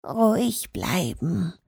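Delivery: calm yourself down, hushed and slow; whispered. Acted. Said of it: "flüsterte er". (leise geflüstert)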